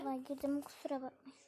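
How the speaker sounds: hushed apology; whispering. Real, not acted. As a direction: whisper a quick sorry.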